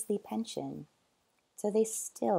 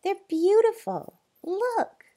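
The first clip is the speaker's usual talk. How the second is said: much higher, louder, bright excitement; boy showing off beautiful animals; eager boy; 13 semitones higher, 9 dB louder, slower than usual. A narrator's reading. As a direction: burst out in wonder.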